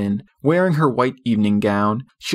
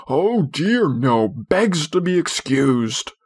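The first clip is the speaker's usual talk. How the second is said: higher, slow, dripping mock sarcasm; sarcastic; 6 semitones higher, slower than usual. A narrator's reading. Father mocks his own tie to his wife, slow cutting sarcasm. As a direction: sneer every word at the tie. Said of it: "He became dangerously sarcastic".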